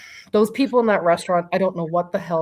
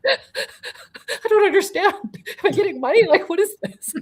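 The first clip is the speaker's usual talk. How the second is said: laughing disbelief; 13 semitones higher, 5 dB louder than usual. Retold or imitated. Reacting to friend's gift prediction.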